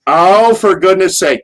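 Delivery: high, loud, slow mock exasperation; exasperated. Real, not acted. Told co-host ruined the reveal, loud slow exasperation. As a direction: groan it like the surprise is spoiled. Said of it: "we know it's Cornette now".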